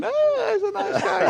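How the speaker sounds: very high, drawn-out mock gushing; imitating Chris. Wife charmed by a tipsy stranger, mimicked high and slow. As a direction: squeal it slowly, mimicking a charmed woman. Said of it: "And she's going".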